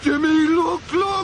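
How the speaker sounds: loud, high cry of alarm; panicked shout, pitched high; alarmed warning. Acted. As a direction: call out urgently to stop him. Warning as boat nears weir.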